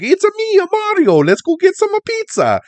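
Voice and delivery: Mario. Acted. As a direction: ham up a cartoon Italian accent, far above your own pitch. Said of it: "It's stereotypical Italian".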